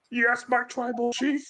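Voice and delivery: high, meek put-on voice; imitated meek aide. Voiced aide answers his chief.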